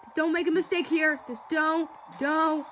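high loud thrilled shrieks; shrieking jackpot winner. Real, not acted. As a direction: shriek with joy at the win. Tense finish and win.

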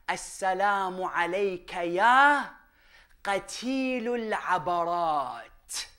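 low, very slow ritual salutation; solemn recitation. Retold or imitated. Preacher reaching the vital lines of the salutation, slow solemn recitation. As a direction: recite it slowly, drawing out each word.

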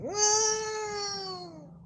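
put-on very high small baby voice; newborn baby. Host imagining a baby fresh from the womb in a very high voice. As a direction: play a newborn babbling for food. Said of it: "You come out the womb".

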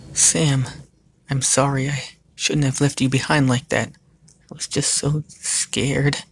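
apologetic Lilith. Acted. Lilith apologises to Sam for leaving him, remorseful.

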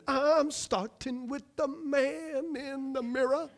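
slow, breathy pop-star croon, half-sung; playful preacher imitating a pop idol. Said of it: "That was my best [name] impression".

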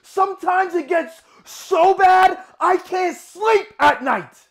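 high, loud, building to a shout; desperate, full-voiced dramatic shouting; anguished outburst. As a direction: cry it out in mounting torment. Monologue, torment builds to outburst.

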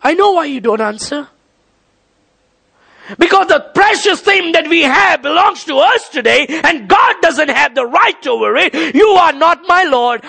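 high, driving pulpit delivery; full-force preaching. Real, not acted. Preacher challenging believers over what is precious to God, full-force preaching. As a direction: preach it hard, pitched high.

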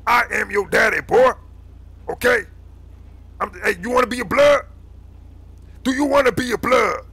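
stern, high-pitched insistence; domineering scolding. Acted. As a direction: press the kid into obedience. Leader overrules the worried kid.

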